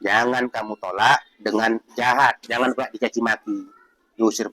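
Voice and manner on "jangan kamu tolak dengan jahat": normal talking voice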